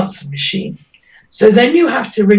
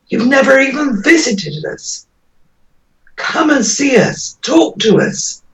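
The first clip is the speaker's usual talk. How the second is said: real, raised-pitch appeal, slowing on key words; impassioned plea; 3 semitones higher than usual. Real, not acted. Relative confronting the commissioners who never visited, impassioned plea.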